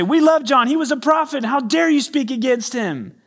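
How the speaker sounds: crowd shouting high and loud in protest; indignant crowd. Retold or imitated. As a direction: cry out in defense of your prophet. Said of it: "the masses who say".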